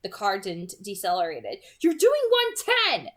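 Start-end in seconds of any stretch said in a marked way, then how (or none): 1.8-3.1 much higher, loud panicked shout. Acted. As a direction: yell it at the speeding driver. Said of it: "I was still shouting".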